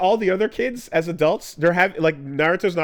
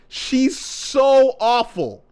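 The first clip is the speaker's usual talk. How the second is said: very slow, stretched "so awful"; real exasperated groan of a line; drawn-out disgust; 7 semitones higher, much slower than usual. Real, not acted. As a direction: lean on "so" and drag "awful" out. Ranting about a character.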